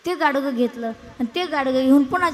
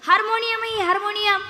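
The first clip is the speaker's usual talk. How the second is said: much higher, stressed harmonium; insisting; 8 semitones higher, 4 dB louder than usual. Retold or imitated. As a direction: lean hard on harmonium twice. Insisting to the puzzled sarpanch.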